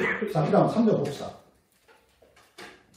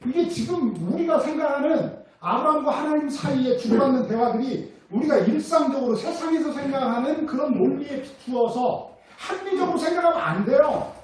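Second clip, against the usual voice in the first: normal talking voice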